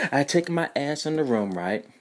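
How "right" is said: ordinary talk, nothing marked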